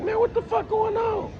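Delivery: retold squeaky protest; high-pitched exasperation. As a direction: voice shoots way up in disbelief.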